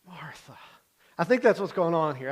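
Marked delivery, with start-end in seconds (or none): 0.0-0.6 a hushed, de-escalating call of the name; quietly saying a spouse's name to de-escalate; soft name. Retold or imitated. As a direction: say it softly to calm a spouse. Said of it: "you say their name".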